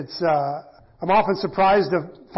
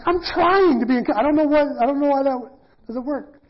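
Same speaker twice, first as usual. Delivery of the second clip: high-pitched flustered self-defence; hammed-up helpless protest, pitched way up; mock frustrated protest; 7 semitones higher than usual. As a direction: whine in exasperation at failing. Acting out his frustrated defence.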